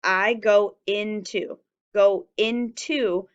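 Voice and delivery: slow, each word said apart. Shown as a demonstration. Teacher counting the words slowly for the class. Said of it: "two words means I need a finger space".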